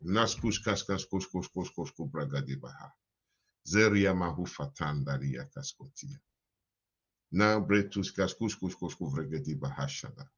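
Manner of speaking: low murmured tongues, falling away; speaking in tongues. Real, not acted. Praying in tongues after prayer.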